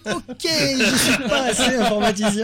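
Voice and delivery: loud, high and slow through laughter; real laughing exclamation; laughing protest. Real, not acted. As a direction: give up laughing, drawing out the OK. Laughing along at the techie jargon banter.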